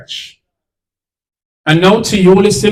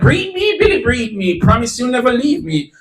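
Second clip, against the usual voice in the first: high-pitched mimicry of a woman in bed; imitated lover's plea; 4 semitones higher than usual. Betrayed woman quotes her rival with Billy, mocking high voice. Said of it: "she was like".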